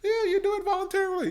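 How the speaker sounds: high, fast sarcastic mimic; mocking voice. Mocking the claim that data sharing is voluntary, high and fast. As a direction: squeak it out like a smug official. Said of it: "you're like".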